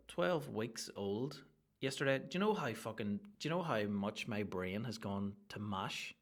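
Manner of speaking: normal talking voice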